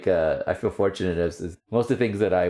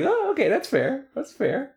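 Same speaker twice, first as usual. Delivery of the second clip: high mock agreement. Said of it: "And I was like".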